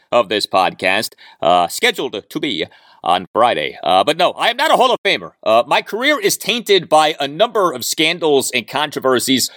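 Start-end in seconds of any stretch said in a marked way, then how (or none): none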